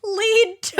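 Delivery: high, laughing repeat; giggling out the words. Real, not acted.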